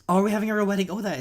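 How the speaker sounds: high, loud thrilled gasp; overjoyed at a surprise wedding. Real, not acted. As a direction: burst out in delight.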